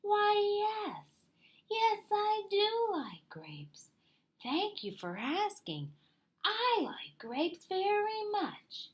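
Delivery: a bashful creature, slow and hushed; shy little possum. A narrator's reading. Shy Possum answering Fox about grapes.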